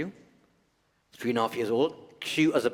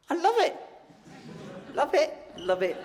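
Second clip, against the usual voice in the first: gushing delight; 3 dB louder than usual. Real, not acted. Exaggerated delight.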